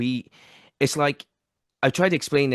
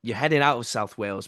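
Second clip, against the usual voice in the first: ordinary delivery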